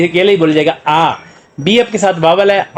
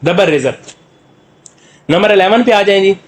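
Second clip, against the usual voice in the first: ordinary talk, nothing marked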